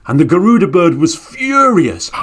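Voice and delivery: plain reading voice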